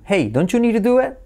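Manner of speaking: "don't you need to do it" as donchu-niru-duet, high; linked, intoned question. Shown as a demonstration. Teacher models English question, linked and high. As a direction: start high on HEY, run DON'T YOU NEED TO DO IT together. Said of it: "el hey Es nuestro truco".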